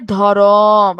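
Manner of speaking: ধরম slow and low, with a long vowel between র and ম; inserted vowel. Shown as a demonstration. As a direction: drag out the second syllable of ধরম. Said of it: "মাঝখানে একটা অধ্বনি আসছে".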